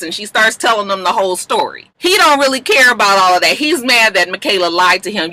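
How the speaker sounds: ordinary talk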